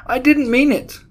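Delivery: very high, loud protest; terrified, high-pitched plea; cried miserably. A narrator's reading. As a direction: wail your innocence in desperation. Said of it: "cried the miserable culprit".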